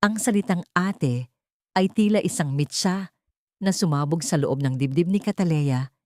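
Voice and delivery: plain reading voice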